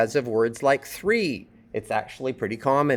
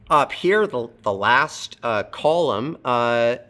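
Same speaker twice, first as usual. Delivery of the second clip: normal talking voice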